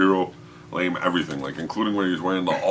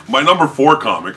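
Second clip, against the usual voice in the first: loud, high host call-out; big high announcer voice; hyped announcement; 9 semitones higher, 12 dB louder than usual. Real, not acted. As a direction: announce it like a countdown reveal. Announcing the countdown entry.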